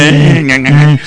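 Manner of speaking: mumbling with dentures fallen out; gummy mumble without the denture; loose dentures. Pretend. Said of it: "Se me cayó la placa".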